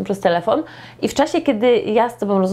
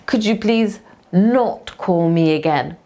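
slow model, pause then firm not; not set apart and emphasised; stress on not; slower than usual. Shown as a demonstration. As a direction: hold back, then lean on not. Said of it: "warto jest to not właśnie tak zaakcentować".

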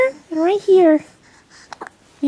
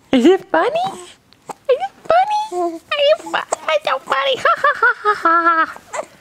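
put-on baby talk; 8 semitones higher than usual. Baby talk teasing infant.

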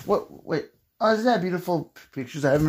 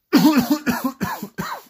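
high-pitched real coughing fit; coughing from laughter; 12 semitones higher than usual. Real, not acted. Player laughed too hard at the game, hacking cough. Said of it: "I'm coughing 'cause I'm laughing too hard".

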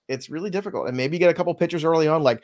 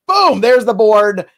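loud triumphant call; gloating shout; 7 semitones higher, 11 dB louder, slower than usual. Real, not acted. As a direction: belt it like a home run call. Gloating replay of home run call.

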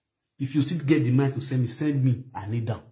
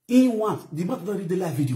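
indignant retort; 5 semitones higher than usual. Retold or imitated. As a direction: fire back her objection at a raised pitch. Retelling her indignant reply.

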